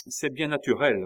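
réplique sèche, plus haute et sonore; répondre sèchement à la protestation; sec. A narrator's reading. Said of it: "reprit sèchement Marie-Antoinette". (sèchement)

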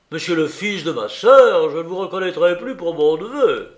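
put-on blustering old soldier's tirade; thundering voice. A narrator's reading. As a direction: berate the nephew with scornful force. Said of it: "s'écria mon oncle du doigt de tonnerre".

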